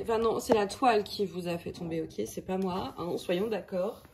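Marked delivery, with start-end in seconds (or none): none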